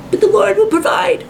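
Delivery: hammed-up high pious reply; mock pious woman. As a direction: chirp it back like a serene believer, mockingly. Acted answer of a woman near forty.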